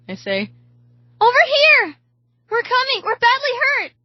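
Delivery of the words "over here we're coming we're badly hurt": very high, desperate screaming; screamed. A narrator's reading. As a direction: scream for help at the top of the range. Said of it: "Anna screams".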